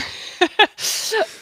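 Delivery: loud, high burst of laughter; real laugh. Real, not acted. Guest laughs at his own dry answer, bursting into laughter. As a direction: laugh out at your own joke.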